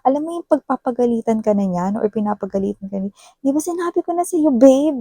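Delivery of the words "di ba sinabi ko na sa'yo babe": scolding, voice jumping much higher; raised angry voice. Retold or imitated. Partner scolding you for doing it again, voice shooting up angrily. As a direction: snap at your partner with a sharply rising voice. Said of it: "tumataas na yung boses niya rito".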